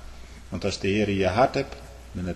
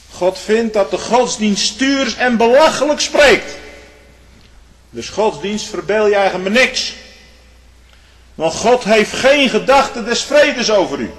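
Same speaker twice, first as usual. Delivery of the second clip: preaching at full force; 10 semitones higher, 8 dB louder than usual. Acted. Preacher to his congregation on God's scorn, thundering. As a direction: hammer it home from the pulpit.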